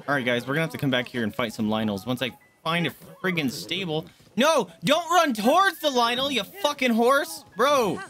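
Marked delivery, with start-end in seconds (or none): 4.4-8.0 high, loud, drawn-out scolding; exasperated yell. Real, not acted. Player scolding horse running toward the Lynel, exasperated yell.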